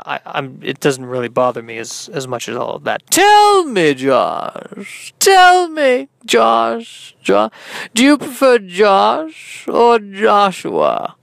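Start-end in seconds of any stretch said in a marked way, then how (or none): 3.1-11.1 high, swooping, probing interviewer; hammed-up host pleading for an answer; Sid Spielman. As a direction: stretch the question out melodramatically. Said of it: "I'm your host, Sid Spielman".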